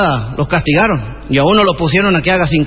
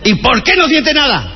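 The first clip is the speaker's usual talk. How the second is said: questioning the recruit sharply, pitched up; barking sergeant; 6 semitones higher than usual. Acted. Sergeant to recruit at drill, a raised barking demand.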